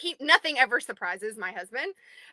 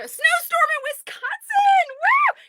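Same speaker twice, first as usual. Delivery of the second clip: high, loud, drawn-out whoop; excited shout; 8 dB louder, slower than usual. Real, not acted. Cheering a viewer snowed in, loud shout. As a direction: holler it out with glee.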